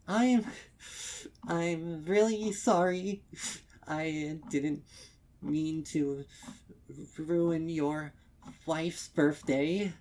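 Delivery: high, loud, fragile pleading apology; tearful apology. Acted. Mare apologizing to the stallion for ruining his wife's birthday. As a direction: say sorry through held-back tears.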